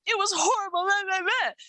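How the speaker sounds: high-pitched retold outpouring of woe; mock gushing complaint. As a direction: mimic her pouring out the horror. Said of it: "listening to her gushing like that".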